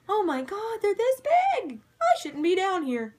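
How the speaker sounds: high pitched imitation of a panicking man; mock panic. Imitating Billy seeing huge cracks, high mock panic. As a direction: squeak it out in a hammed-up fright.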